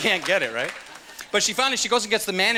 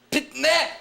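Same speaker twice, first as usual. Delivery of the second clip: high, abrupt "pit-meh"; excited made-up noise; 10 semitones higher than usual. Shown as a demonstration. Comic showing own excitement noise, high yelp. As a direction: spit out a silly high yelp. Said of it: "Whenever I get excited, I go".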